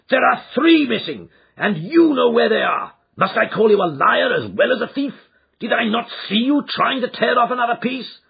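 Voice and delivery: loud, high, furious demands; angry accusation. Acted. Father accusing his son of lying and theft. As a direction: corner him with your anger.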